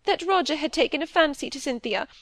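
high-pitched tearful protest, acted; through sobs. A narrator's reading. Wife protests what she knew, acted sobbing. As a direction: carry on high, as if through tears. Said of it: "through sobs and tears".

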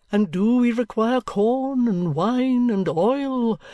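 high, slow, unctuous sermonizing voice, drawn out; a sleek preacher savouring every rhetorical question; oily preacher. Acted. Chadband preaching to the gathered company in a high, slow, oily voice.

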